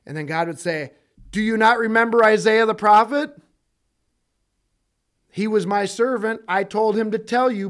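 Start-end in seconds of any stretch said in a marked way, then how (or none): 1.3-7.7 louder and higher reproach; God's rebuke. Acted. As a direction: scold them from the judgment seat. God rebukes the unbelievers.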